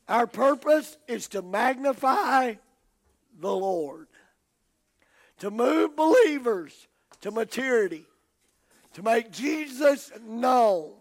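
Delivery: loud, slow, ringing declaration; preacher's proclamation. Real, not acted. Preacher declaring the church's purpose to the congregation, forceful and slow. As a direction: lift the key words and stretch them out.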